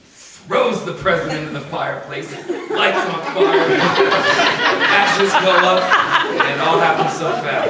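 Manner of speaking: words pushed out through loud laughter; can't get through it without laughing; laughing while telling. Real, not acted. Laughing while retelling prank.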